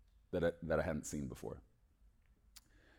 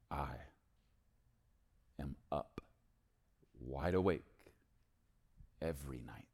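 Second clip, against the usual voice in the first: tender quiet voice; 5 semitones lower, 7 dB quieter than usual. Retold or imitated. God's tender words retold.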